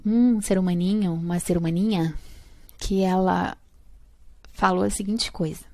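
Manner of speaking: ordinary talk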